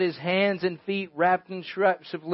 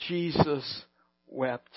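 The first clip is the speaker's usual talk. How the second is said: hushed reverence; 4 semitones lower, 6 dB quieter, much slower than usual. Shown as a demonstration. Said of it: "remember and take encouragement".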